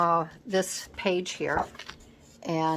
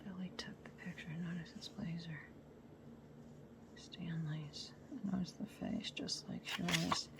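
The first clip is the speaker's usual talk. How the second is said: whispered reading; 18 dB quieter than usual. Real, not acted. Crafter reading a photo's old notes aloud, whispering. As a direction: breathe the words out under your breath.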